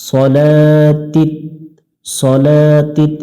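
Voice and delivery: slow সলাতিত with a short first vowel; careful সলাতিত; correct Arabic. Shown as a demonstration. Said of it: "ভুল করে সালাতিল".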